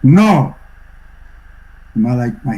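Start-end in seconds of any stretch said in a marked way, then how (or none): 0.0-0.6 a retold emphatic refusal, volume kept normal; short and emphatic, without shouting. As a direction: imitate a firm, decisive refusal. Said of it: "in a very emphatic voice".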